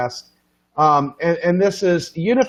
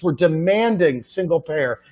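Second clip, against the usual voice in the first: ordinary delivery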